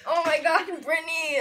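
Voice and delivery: ordinary delivery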